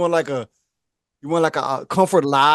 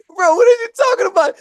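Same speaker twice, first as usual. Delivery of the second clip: exasperated; 13 semitones higher, 5 dB louder than usual. Real, not acted. Exasperated at absurd lie.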